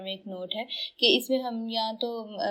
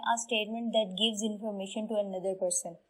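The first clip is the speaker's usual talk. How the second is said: 1 definition read slowly; much slower than usual. Shown as a demonstration. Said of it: "ये वो sentences होते हैं".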